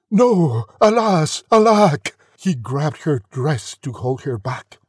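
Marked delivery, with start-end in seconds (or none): 0.1-2.2 loud, high melodramatic lament; anguished cry. A narrator's reading. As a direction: cry out each word, louder each time. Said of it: "The prince cried".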